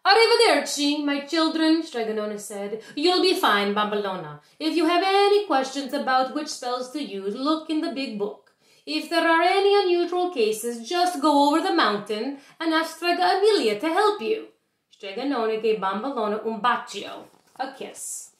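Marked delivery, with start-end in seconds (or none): 0.0-1.8 lightly voiced old Italian nonna, a bit higher; sweet old witch telling her children news; a hint of a kindly grandma; gentle grandmother voice. Stregonona's farewell. 2.9-14.5 lightly voiced old Italian nonna, a bit higher; sweet old witch telling her children news; a hint of a kindly grandma; gentle grandmother voice. Stregonona voiced.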